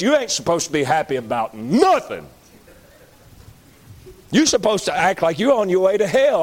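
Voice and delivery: ordinary talk, nothing marked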